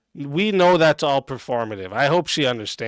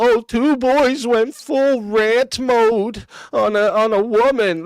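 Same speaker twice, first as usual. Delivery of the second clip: high, slow whiny mock of her complaint; high sneering mock of her words; mocking whiny imitation; 8 semitones higher, 4 dB louder, slower than usual. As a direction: parody her victim line, dragging it out. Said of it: "this whole".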